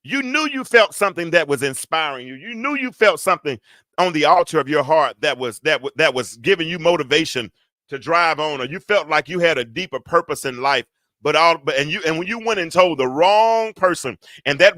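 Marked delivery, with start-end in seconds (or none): none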